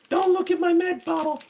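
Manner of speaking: squeaky high voice. Pretend. Streamer squeaking about his med bottle, very high. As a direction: squeal it like a cartoon.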